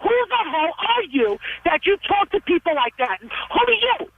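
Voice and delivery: real indignant anger, pitch jumping up; angry challenge. Real, not acted. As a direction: demand to know who they think they are. Mother challenges caller angrily.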